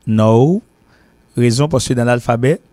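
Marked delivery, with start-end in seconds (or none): 0.1-0.6 "no" said with a long English o glide. Shown as a demonstration. Teacher to class, showing English "no". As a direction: say "no" the American way, rounded o. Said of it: "ça s'écrit N O".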